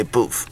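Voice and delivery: "booth" said as "boof"; local pronunciation. Shown as a demonstration. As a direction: end "booth" on F instead of TH. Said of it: "We say booth".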